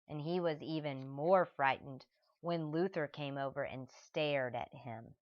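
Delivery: plain reading voice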